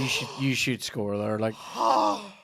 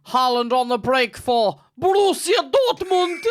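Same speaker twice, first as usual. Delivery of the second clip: high, loud, slow mock commentator call; BT Sport commentator; 10 dB louder than usual. Mocking a TV pundit on the co-host's show, loud commentator call. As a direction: ham up the BT Sport guy's breathless call. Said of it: "You have the B T Sport guy".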